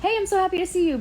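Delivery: high, chirpy welcome; sing-song happy hello; lightly mocked Bella warmth; mock cheerful greeting. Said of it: "Bella wasn't even like".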